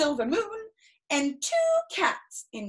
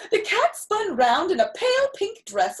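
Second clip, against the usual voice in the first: ordinary delivery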